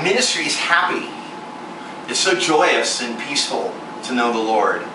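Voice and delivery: ordinary talk, nothing marked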